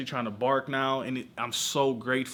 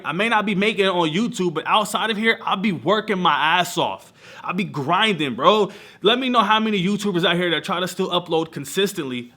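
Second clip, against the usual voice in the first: loud, driven, preaching hustle; fired-up rant; 5 semitones higher, 6 dB louder than usual. Real, not acted. Vlogger hyping viewers about his work ethic, loud rant. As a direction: hammer home how hard he grinds.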